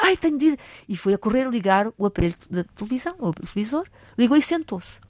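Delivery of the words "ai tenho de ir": put-on high, louder old granny hurrying off; a granny in a rush to catch the news; grandmother's voice. Colleague's grandmother rushing to the TV, high and hurried.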